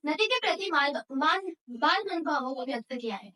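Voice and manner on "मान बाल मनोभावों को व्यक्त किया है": normal talking voice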